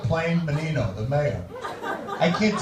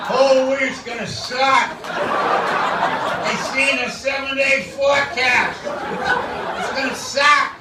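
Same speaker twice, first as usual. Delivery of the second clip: put-on grumpy Boston accent; 7 semitones higher than usual. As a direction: moan about the week like a loudmouth Bostonian. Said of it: "obviously from Boston".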